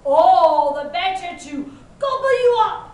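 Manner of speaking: loud roar rising in pitch; big, loud storybook wolf. A narrator's reading. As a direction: build to a pouncing wolf's roar. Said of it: "roared the wolf".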